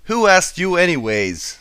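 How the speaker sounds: a curt snap at her brother; snapped. A narrator's reading. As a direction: cut him off sharply. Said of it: "snapped Applejack".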